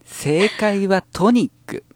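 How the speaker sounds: put-on high, slow haughty rich girl; Kurosawa Dia. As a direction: announce the answer like a proud ojou-sama. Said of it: "ラブライブサンシャインの黒沢ダイヤさん".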